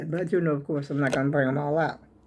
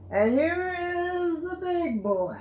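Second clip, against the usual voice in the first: proud reveal; 12 semitones higher, slower than usual. Real, not acted. Showing off largest blanket.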